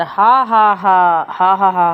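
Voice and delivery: mock hearty laughter for the class; demo laugh ha ha. Shown as a demonstration. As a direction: act out the listeners laughing with a hearty ha ha ha. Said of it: "ऐसा कृत्वा करके हंसते हैं".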